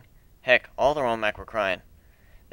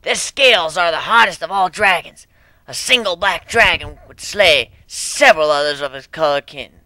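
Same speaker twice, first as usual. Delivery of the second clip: a hint of an old elder, higher and louder; elder proclaiming; 6 semitones higher, 8 dB louder than usual. Elder continues explaining.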